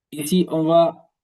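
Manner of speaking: ordinary talk, nothing marked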